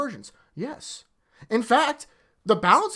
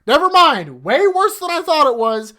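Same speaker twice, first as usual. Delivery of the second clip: higher, louder, fed-up disbelief; loud and higher, still heated; exasperated loud; 7 semitones higher, 7 dB louder than usual. Real, not acted. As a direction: push it out in angry disbelief. Reacting to worse news.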